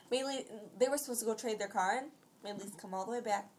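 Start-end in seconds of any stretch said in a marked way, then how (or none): none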